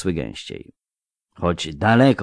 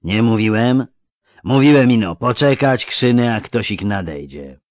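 louder, higher rough-talking highwayman; lightly acted bandit, gloating to his mates; harking thug; 4 semitones higher, 6 dB louder than usual. A narrator's reading. One robber to his gang as they block the road, harking. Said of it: "harknął jeden z tych".

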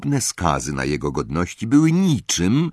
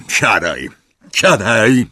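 loud barked command; thundering order; 4 semitones higher, 6 dB louder, slower than usual. A narrator's reading. Uncle thundering at Harry to sit. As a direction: boom the order twice. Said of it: "zagrzmiał wuj Vernon".

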